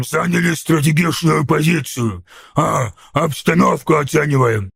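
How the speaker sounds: hesitant ork making an excuse; put-on ork voice, faltering a little; timidly daring to speak up. A narrator's reading. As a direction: offer a lame excuse in an ork voice. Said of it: "отважился высказаться храбрец".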